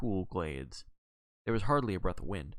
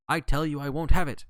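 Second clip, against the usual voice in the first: loud, higher indignant shout; 6 semitones higher, 9 dB louder than usual. A narrator's reading. John making a fuss in a dream, shouting out. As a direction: shout it out, putting your foot down. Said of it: "to go shouting out".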